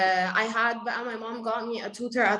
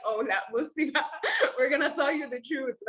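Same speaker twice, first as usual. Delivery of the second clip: laughing through the confession; giggling as she gives away the family secret; 4 semitones higher than usual. Real, not acted. Mom finally tells her daughter the truth, laughing.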